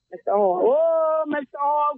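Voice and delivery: ordinary delivery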